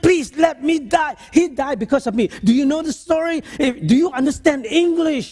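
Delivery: high, loud pleading patient voice; desperate patient. Retold or imitated. Psychologist retelling patient begging him, high and loud. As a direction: beg to be left to die.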